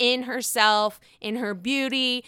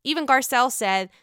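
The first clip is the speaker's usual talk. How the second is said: ordinary talk, nothing marked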